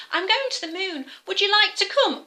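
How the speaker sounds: cheerful bear, lifting the invite. Acted. Bear answers the owl.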